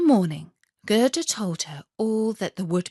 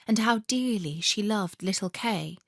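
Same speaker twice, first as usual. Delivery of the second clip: usual speaking voice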